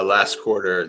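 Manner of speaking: ordinary talk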